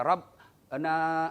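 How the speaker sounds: slow, stretched "Rabbana"; drawn-out recitation. Shown as a demonstration. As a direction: chant it out long and slow. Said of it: "kita baca".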